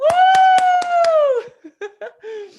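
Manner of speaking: shrieking, loud burst of laughter; loud high whoop of laughter. Real, not acted. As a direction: let a huge joyful laugh fly up high. Host laughing after farewell.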